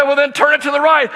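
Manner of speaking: fast, high, loud instruction; urgent shout. Retold or imitated. Dad shouting steering advice to his daughter, urgent yell. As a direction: yell the fix across the lot.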